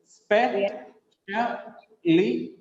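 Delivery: very slow "Speciality", spe, SHA, li, ti; syllable by syllable. Shown as a demonstration. Teacher breaking down the word, "Speciality" in separate beats. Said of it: "Li, T are last two syllables".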